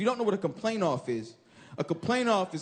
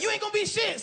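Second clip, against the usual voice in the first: mock scornful shout; 12 semitones higher than usual. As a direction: jeer at the dreamer. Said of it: "one person like".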